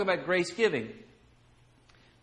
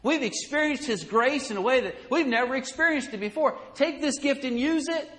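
eager pleading; 6 semitones higher than usual. Retold or imitated. Macedonian churches begging Paul to take the offering. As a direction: beg him warmly to accept. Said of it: "with much entreaty".

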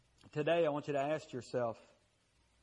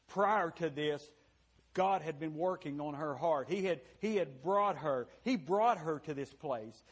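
ordinary talk

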